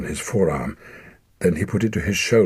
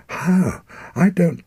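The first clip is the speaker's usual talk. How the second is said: put-on frightened boy; 12 semitones higher, 5 dB louder, slower than usual.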